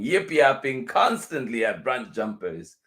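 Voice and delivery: ordinary delivery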